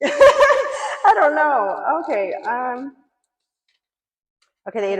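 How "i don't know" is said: real laugh, high and loud, words drawn out. Real, not acted. Wondering if she can swear on YouTube, laughing loudly. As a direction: laugh through the words, unsure if swearing is allowed. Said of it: "Can I drop F-bombs on YouTube?".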